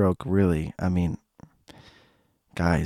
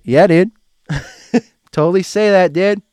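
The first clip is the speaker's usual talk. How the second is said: loud dude; 10 semitones higher, 7 dB louder than usual. Acted. Mocking own slang.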